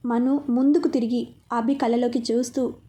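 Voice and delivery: ordinary delivery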